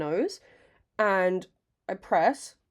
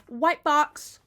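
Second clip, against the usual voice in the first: brand reveal; 6 semitones higher, 5 dB louder than usual. Real, not acted.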